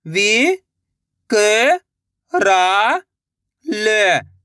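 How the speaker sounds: "विकराल" very slowly, each syllable held; sounding out slowly. Shown as a demonstration. Teacher sounding out a word for young readers. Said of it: "एक अक्षर का उच्चारण करके".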